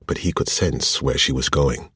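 ordinary delivery, nothing marked

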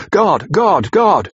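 desperate calls to God, climbing; loud cry. A narrator's reading. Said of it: "had called out loudly several times".

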